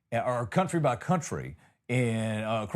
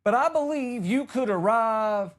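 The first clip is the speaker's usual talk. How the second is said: normal talking voice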